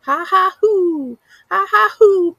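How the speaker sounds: high, loud ha ha hoo cackle; a wild creature jeering at leashed dogs; mocking animal laugh. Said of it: "I laugh like this".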